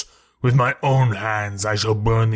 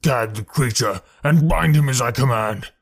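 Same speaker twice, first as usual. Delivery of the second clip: furious command; 3 semitones higher, 5 dB louder than usual. A narrator's reading. Tal Hajus enraged, commanding.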